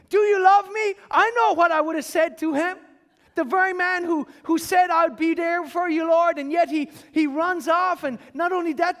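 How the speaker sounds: loud, rising sermon delivery; fervent preaching. Acted. Pastor urging the watching congregation, full-force preaching.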